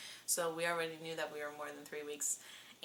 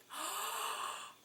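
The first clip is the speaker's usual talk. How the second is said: gasp. Retold or imitated. Husband sees positive test.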